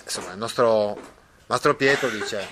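ordinary talk

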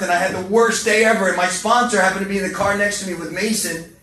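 ordinary talk, nothing marked

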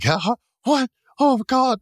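high, startled gasping questions; shocked wife. Retold or imitated. Wife seeing her husband's corpse, high mock shock. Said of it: "she's like".

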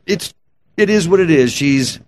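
ordinary talk, nothing marked

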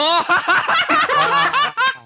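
loud, high-pitched guffaw; burst of laughter. Real, not acted. Co-host cracks up at the breakup-spot joke, loud laughter.

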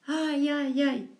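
high wailing moans; wolf's wailing. A narrator's reading. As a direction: moan in pain like a trapped wolf. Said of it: "reanudó los aullidos y lamentos".

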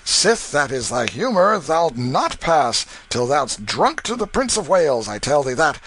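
block his way, teasing but firm; resolute drunk waterman. Acted. The waterman barring Canty, tipsy and firm. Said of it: "said the waterman, barring the way resolutely".

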